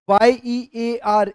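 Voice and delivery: letters spelled out slowly and clearly; slow spelling. Shown as a demonstration. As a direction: dictate each letter slowly so students catch it. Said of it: "ध्यान से सुनना".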